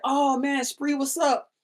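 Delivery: high, warm greeting. Retold or imitated. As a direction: greet him like an old friend. Voicing [name]'s greeting.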